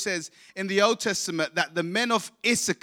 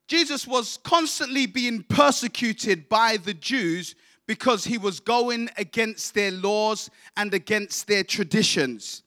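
usual speaking voice

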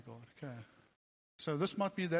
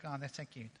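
cutesy voice; 2 semitones lower, 9 dB quieter than usual. Shown as a demonstration. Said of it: "I say it cutely because it sucks".

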